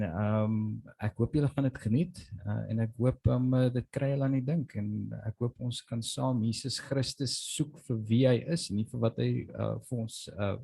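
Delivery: ordinary talk, nothing marked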